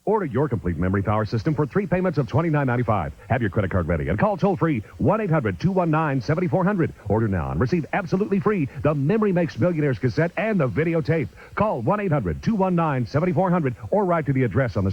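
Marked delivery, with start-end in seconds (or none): 0.0-10.6 rapid-fire pitch. Acted. Fast closing sales pitch.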